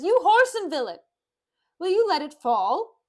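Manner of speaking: high, loud theatrical outrage; angry shouting. Acted. Petruchio to servant who spilled water, shouting angrily. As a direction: lay into the clumsy servant.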